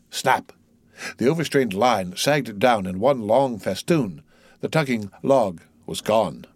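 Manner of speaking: plain reading voice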